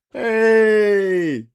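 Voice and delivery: high, loud drawn-out shout; big falling cry; punchline cheer. Acted. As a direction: holler a long 'hey' after the punchline. Punchline cry after joke.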